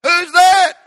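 sharp high call of who's there; startled challenge. Retold or imitated. Doorkeeper calling out a sharp, high who's-there. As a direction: demand who's at the door. Someone at the tabernacle door.

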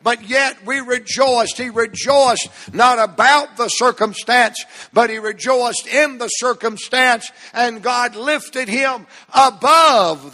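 full-voiced pulpit delivery; impassioned preaching. Real, not acted. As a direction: preach it with rising fervor, landing hard on key words.

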